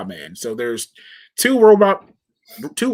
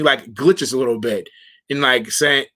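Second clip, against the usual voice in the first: normal talking voice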